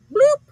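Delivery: high, loud "bloop"; imitated spill noise. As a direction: pop out a high spilling sound.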